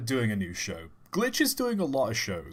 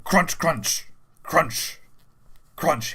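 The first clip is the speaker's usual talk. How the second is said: punched, higher-pitched crunches; mock whip lashes; 5 semitones higher, 4 dB louder, much slower than usual. Joke teller acting out the whipping, punched crunches. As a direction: hit each crunch like a lash.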